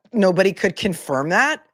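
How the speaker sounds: usual speaking voice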